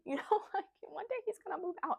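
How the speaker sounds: high, laughing lament; mock-tearful dread. Real, not acted. Mother dreading her son moving out, half-laughing lament. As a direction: pitch it up high in half-joking dismay.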